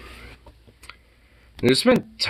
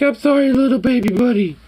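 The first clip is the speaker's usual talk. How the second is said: high, louder cooing apology; baby talk apology; 4 dB louder than usual. Retold or imitated. Owner apologizing to the kitten in high baby talk. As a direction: coo sorry to the kitten. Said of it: "it's like".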